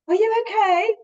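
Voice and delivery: high, loud, lilting check-in; concerned greeting. Retold or imitated. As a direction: call it out high to a stranger, pitch lifted. Retells greeting a sad stranger.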